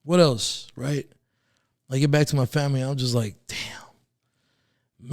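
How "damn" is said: breathless whisper of despair; whispered damn. Retold or imitated. Back home with family, whispering damn to himself. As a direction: whisper it to yourself, defeated.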